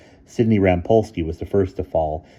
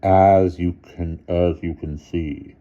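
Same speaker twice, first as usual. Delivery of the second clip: slow cold headmaster; icily; 6 dB louder, slower than usual. A narrator's reading. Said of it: "he said icily".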